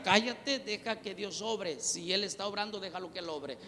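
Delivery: quiet it down to a near hush; softly telling the wife to be quiet; hushed rebuke. Retold or imitated. Hushing wife during prayer.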